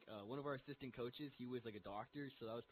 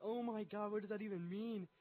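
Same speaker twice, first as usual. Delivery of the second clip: higher-pitched bewildered outburst; mock panic; 7 semitones higher than usual. Patient hearing a baffling diagnosis, high mock-panicked reaction. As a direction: imitate yourself freaking out in confusion. Retold panic at doctor's long word.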